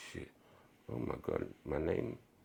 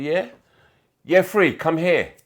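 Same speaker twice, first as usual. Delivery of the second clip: calling out; 11 semitones higher, 14 dB louder, slower than usual. Real, not acted. Calling kids in playground.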